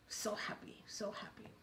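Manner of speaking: soft whispered delight; hushed whisper. A narrator's reading. Storyteller tells audience of the poor man's joy, whispering.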